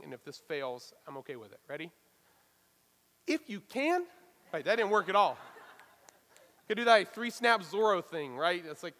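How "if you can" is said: "If you can" thrown back high and sharp, offended disbelief; incredulous "If you can". Retold or imitated. Pastor acting Jesus, retorting to the doubting father, mock offended. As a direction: toss "If you can" back like an insult, pitched up. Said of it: "I'm going to try something".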